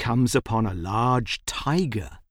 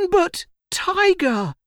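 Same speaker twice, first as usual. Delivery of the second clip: put-on high-pitched boy's protest; crying out. A narrator's reading. Boy cries to the pouncing tiger, alarmed. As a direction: cry out in alarm, much higher. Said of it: "cries Rahul".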